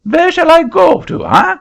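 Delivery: put-on very high, loud French-accented protest; an indignant Frenchman in a shrill-high French accent; angry French accent. Frenchman refusing the auctioneer's seat, high loud French accent.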